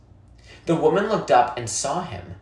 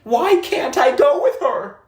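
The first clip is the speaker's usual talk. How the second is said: put-on much higher, urgent pleading boy; rising panic; 13 semitones higher, 5 dB louder than usual. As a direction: panic breaking through the boy's thoughts. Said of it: "as panic rose inside him".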